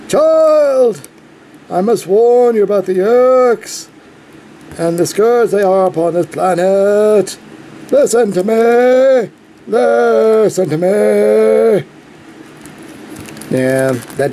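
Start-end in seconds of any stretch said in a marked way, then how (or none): 0.1-11.9 pompous alien warning. Retold or imitated. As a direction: grandly warn the child of doom. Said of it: "he'd be going like".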